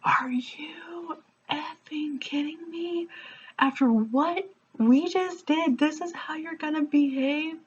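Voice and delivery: put-on retelling her blowup at the boyfriend in the parking lot; losing it. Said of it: "And I just lost it".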